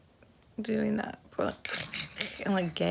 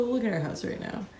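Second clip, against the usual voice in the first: usual speaking voice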